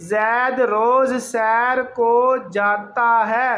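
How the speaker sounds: slow, word-by-word sentence reading; slow careful reading. Shown as a demonstration. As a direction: read it out slowly like a teacher. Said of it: "جاتا، ہے زبر بڑی یہ ہے".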